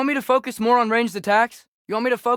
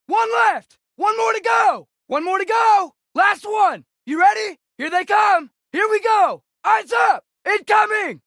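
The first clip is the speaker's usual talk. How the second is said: urgent battle shouts; 7 semitones higher than usual. Acted. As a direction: call out the threat to your squad. Warning teammate of enemies.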